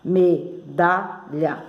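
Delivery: me-da-lha, each syllable slow and separate. Shown as a demonstration. Teacher splitting the word for learners, syllable by syllable. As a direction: break "Medalha" into three spaced syllables. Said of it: "Três sílabas também".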